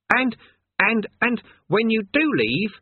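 put-on high, earnest young lad; high boyish voice. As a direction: sound like a know-it-all boy. Eric voice.

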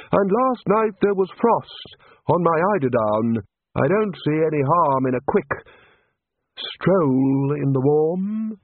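high, slow wistful plea; longing for warmth, slow and high. A narrator's reading. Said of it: "looking wistfully at the sun-drenched beach".

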